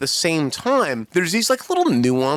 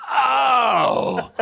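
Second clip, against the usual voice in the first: dramatic oh; 9 semitones higher than usual. Retold or imitated. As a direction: make the oh heavy with hints. Mother hints at something, exaggerated.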